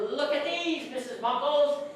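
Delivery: excited showing off. Retold or imitated. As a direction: proudly present the find like a pupil. Retold proud showing to teacher.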